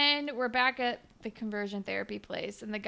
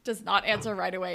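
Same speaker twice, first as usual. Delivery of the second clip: ordinary talk, nothing marked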